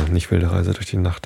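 ordinary delivery

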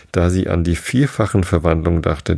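ordinary delivery, nothing marked

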